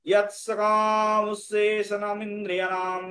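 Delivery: ইয়াত সকাম উচ্ছেষণম ইন্দ্রিয়াণাম chanted as one sustained line; flowing Sanskrit verse chant; beautifully joined recitation. Shown as a demonstration. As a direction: join the whole line smoothly on a held tone. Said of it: "এবার সুন্দর করে উচ্চারণ করি".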